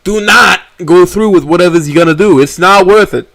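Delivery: high, loud, urgent pleading; frantic and worried. Acted. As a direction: grab her attention in a panic.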